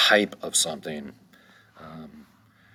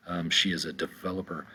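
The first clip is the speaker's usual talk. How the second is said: normal talking voice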